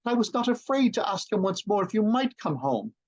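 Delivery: put-on high, light child's voice; little Fan's voice. A narrator's reading. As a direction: voice the eager little sister. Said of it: "He spoke so gently".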